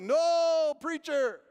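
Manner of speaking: loud, high, drawn-out crowd answer; congregation's response. Retold or imitated. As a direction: holler back at the preacher. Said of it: "And they went".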